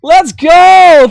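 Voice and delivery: very high, loud, ecstatic screams; overjoyed high-pitched yelling at a goal reached; real celebratory shouting. Real, not acted. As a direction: shout it out, thrilled, stretching the big words. Celebrates hitting the stream goal.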